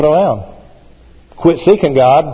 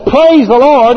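much higher and louder "Praise the Lord"; exclaimed praise; 10 semitones higher, 5 dB louder, slower than usual. Shown as a demonstration. Preacher bursting out with the verse to his congregation, exclaiming. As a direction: shout it out in joy. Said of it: "Oh, it says".

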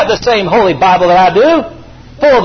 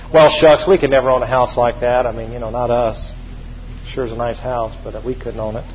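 sinking lower and quieter into resignation; defeated talker; 7 semitones lower, 4 dB quieter than usual. Retold or imitated. Mocking a self-defeating believer, mildly hammed resignation. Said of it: "You ever hear anybody talk like that?".

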